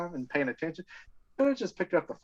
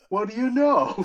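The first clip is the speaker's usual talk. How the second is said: amused cop; 8 semitones higher, slower than usual. Retold or imitated. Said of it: "He goes".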